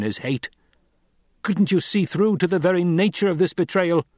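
high, loud, scolding questions; impassioned rebuke. Acted. Young lord rebuking his father's old friend, impassioned. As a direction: drive each question at him. Narrator voices Paul rebuking.

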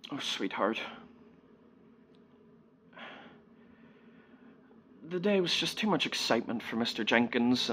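soft, hesitant breaking of bad news; hushed motherly apology with long pauses; consoling mother. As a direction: break it gently to a small child.

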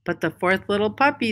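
usual speaking voice

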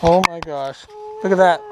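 usual speaking voice

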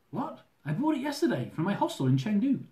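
high, disbelieving outburst; surprised. Acted. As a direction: burst out in disbelief. Shocked at ticket news.

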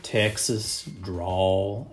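slow, soft drawled Texas drawl. Shown as a demonstration. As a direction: stretch the words out lazily. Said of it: "we have the".

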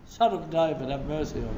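usual speaking voice

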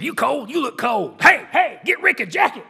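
high, loud shout for a jacket; coach yelling. Retold or imitated. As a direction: holler down the sideline.

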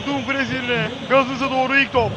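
usual speaking voice

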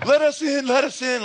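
high, loud pleading cry to be let in; desperate cry. Acted. The shut-out people begging God, a high loud cry. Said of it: "They'll so cry out to God".

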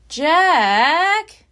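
put-on girl's loud call. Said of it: "Annie called".

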